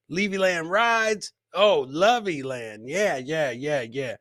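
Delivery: normal talking voice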